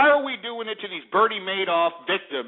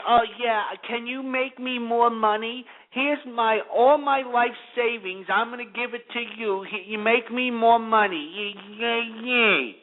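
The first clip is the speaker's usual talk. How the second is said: higher, slowed, simpering gullible investor voice; mock naive investor; 4 semitones higher, slower than usual. Mocking investors handing Madoff their savings, simpering. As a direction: play a gullible sucker handing over savings.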